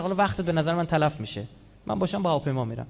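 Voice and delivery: ordinary talk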